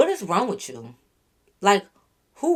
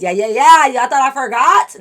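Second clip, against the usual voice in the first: much louder and higher, slower on the question; teasing callback; 4 semitones higher, 10 dB louder than usual. Real, not acted. Vlogger teasing viewers who thought she forgot, loud and playful.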